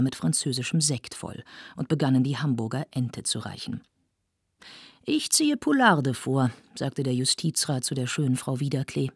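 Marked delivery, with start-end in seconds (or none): 5.0-6.5 slow, higher-pitched, relishing declaration; savoring gourmet. A narrator's reading. Justice councillor to a beautiful lady at dinner, savoring. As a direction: savor the dish as you name it.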